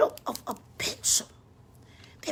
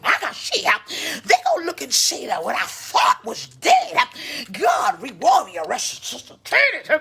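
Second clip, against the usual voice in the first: louder, rising preacher's call and response; preaching at full force; 7 dB louder than usual. Acted. Preacher proclaiming resurrection to the congregation, shouting. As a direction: drive it home like a revival preacher.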